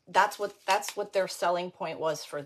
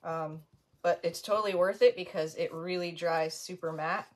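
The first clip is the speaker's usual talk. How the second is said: ordinary delivery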